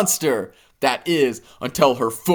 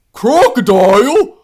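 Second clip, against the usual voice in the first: higher, loud, slow rising question; indignant disbelief; 9 semitones higher, 8 dB louder than usual. Acted. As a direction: repeat the insult like you can't believe it. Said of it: "Unable to believe that the hippopotamus called him a crocodile".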